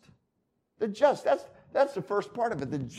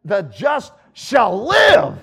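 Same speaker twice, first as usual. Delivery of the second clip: slow, loud shout; fired-up shout; 2 semitones higher, 9 dB louder, much slower than usual. Shown as a demonstration. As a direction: proclaim it to rouse them. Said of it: "ought to get you excited".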